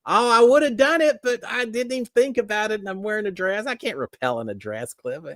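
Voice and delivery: high, mock-innocent excuse; imitating wife. Wife excusing herself to husband from rappelling in a dress, imitated.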